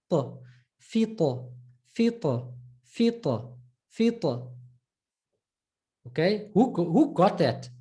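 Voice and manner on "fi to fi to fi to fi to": "fi to" repeated slowly, emphatic t; drilled fito. Shown as a demonstration. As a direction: drill "fi to" with a curved tongue. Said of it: "curve the tongue".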